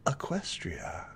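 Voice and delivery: whispered sly fox; slick, sly. Acted. Fox turns to the visitor, sly whisper. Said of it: "like, slickly".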